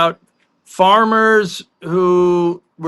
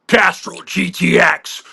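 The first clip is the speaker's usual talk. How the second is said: slow, drawn-out ad announcer voice; commercial voice-over; slower than usual. Retold or imitated. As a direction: imitate the actor's TV commercial tagline read. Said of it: "You mean this?".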